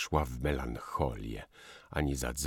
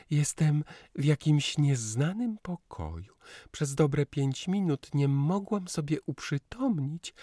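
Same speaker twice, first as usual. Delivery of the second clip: put-on high, playful young woman's voice; chirpy Lusia telling a story; 12 semitones higher than usual. A narrator's reading. Lusia tells her aunt about waking up, high and playful. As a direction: tell it teasingly to her aunt, pitched high.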